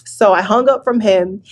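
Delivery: ordinary talk, nothing marked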